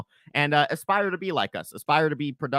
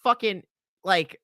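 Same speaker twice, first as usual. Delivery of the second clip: contemptuous rant; 7 semitones higher, 8 dB louder than usual. Acted. Ranting insult at Jared.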